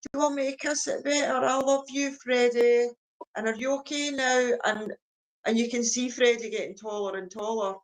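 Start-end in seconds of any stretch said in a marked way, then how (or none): 0.0-4.5 put-on high, soothing little-boy voice; kissing it better. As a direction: comfort a hurt toddler sweetly. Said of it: "and he'll be like".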